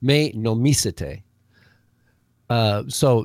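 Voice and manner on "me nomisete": "nomisete" with the stress on its second syllable, said slowly; slow teacher's demo: "Me nomisete", stress on no-MI; accent on second syllable. Shown as a demonstration. Greek teacher correcting the host's reading, slow stress on "nomisete". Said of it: "the accent's on the second syllable".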